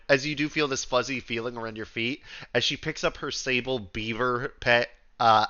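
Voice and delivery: ordinary talk, nothing marked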